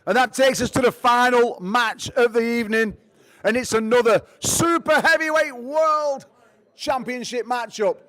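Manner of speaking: big, loud, drawn-out fight-night introduction; ring announcer intro. Acted. Announcer presents Nick Stone to the crowd, loud and showy. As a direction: hype the crowd for the final match.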